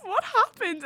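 high, loud imitated question; mock bewilderment. Host reading out an older woman's comment, mock bewilderment. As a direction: read it as a baffled commenter, pitched right up.

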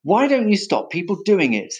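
high-pitched exasperated demand; angry shout. A narrator's reading. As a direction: demand it crossly of the culprit. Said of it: "shouted the elephant master".

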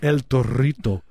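El Torito with a rolled Spanish r, drawn out; Spanish accent. Shown as a demonstration. Comic showing off a restaurant name, hammed-up Spanish accent.

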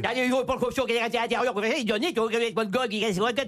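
garbled mock Picard gibberish; northern French patois. Comic shows what a Texan would face, thick northern French patois. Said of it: "tu imagines un Texan qui arrive dans la Somme ou dans le Nord".

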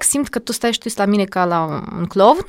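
usual speaking voice

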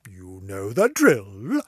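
a knowing low drawl for the sign-off; low, slow catchphrase. Acted. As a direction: drop the voice low and drawl it out slowly.

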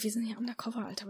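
unvoiced undercover whisper. Sprayer hiding at night, whispering to his buddy. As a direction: breathe it so nobody hears.

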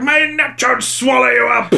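big booming sea-curse, high and loud; booming Willem Dafoe. Retold or imitated. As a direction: bellow it like an old sea captain. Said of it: "that big booming voice".

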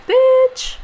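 playful bitch. Shown as a demonstration. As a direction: sing out "Bitch." high and playful. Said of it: "Not like that".

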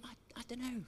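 a feeble, cornered answer; sheepish reply. Retold or imitated. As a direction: shrug it off meekly and very quietly. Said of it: "And he'd be like".

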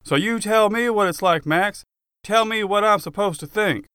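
half screaming. Acted. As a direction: demand an answer, furious. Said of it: "half screaming at me".

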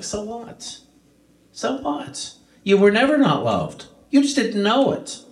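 high, brushing-off "so what" then insisting; fond father. Acted. Father dismissing his son's shame, high and fond. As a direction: wave away the son's guilt, lifted and eager. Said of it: "And the father's like".

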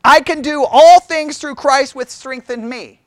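loud, slow, declaiming the verse as it's usually quoted; proclaimed verse. Shown as a demonstration. Teacher reciting the often misquoted verse to the class, slow and loud. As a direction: proclaim it like a slogan. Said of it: "a passage that gets misquoted".

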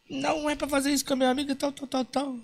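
high, fast mock scolding voice; scolding friend. Girl's friend scolds the dwarf, high fast mock voice.

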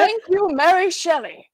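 high, sarcastic mock gratitude; sarcastic praise for a spooky storyteller; sarcastic thanks. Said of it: "sarcastic quote, much higher".

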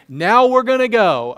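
a loud, high, hammed-up complaint; exasperated disciples. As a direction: voice the disciples' comic frustration.

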